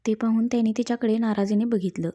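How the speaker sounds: plain reading voice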